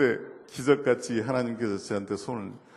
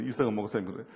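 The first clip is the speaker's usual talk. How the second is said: ordinary delivery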